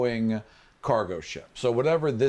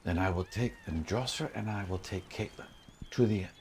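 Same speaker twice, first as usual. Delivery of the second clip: hushed conspirator; 5 semitones lower, 9 dB quieter, faster than usual. Acted. GM plays Jostra, hushed urgency.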